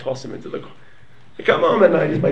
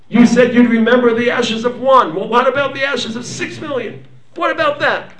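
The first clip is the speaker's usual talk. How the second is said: impassioned protest; 6 semitones higher, 5 dB louder than usual. Acted. Pleading with God over the Holocaust.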